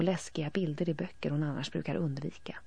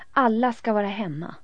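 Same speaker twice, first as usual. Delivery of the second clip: loud demand; 6 semitones higher, 9 dB louder than usual. A narrator's reading. Elin demanding.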